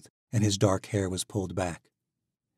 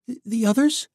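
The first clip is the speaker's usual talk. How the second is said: much higher, worried stammer; anxious stammer; 7 dB louder than usual. Acted. Misha asks Alec about their friends, high and anxious. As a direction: fear for her friends.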